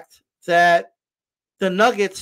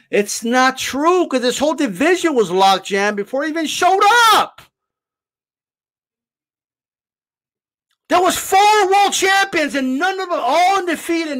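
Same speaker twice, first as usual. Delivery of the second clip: raised, high-pitched indignant protest; heated rebuttal; 7 semitones higher, 4 dB louder than usual. Real, not acted. Host rebuts the claim Canelo held up the division, heated and loud. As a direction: argue it loudly, voice climbing high.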